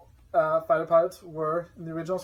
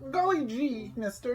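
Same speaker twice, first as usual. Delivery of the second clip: put-on wholesome 1950s kid; 8 semitones higher, slower than usual. Aw-shucks exclamation after reveal.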